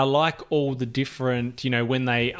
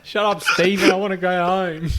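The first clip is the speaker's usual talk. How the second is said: high, louder fake-sob whine; hammed-up tearful tantrum, like a kid; mock whiny crying; 6 semitones higher, 6 dB louder than usual. Cohost mimicking a choked actor begging to leave, mock crying whine.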